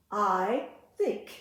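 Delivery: normal talking voice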